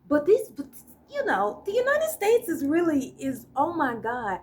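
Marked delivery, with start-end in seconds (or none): none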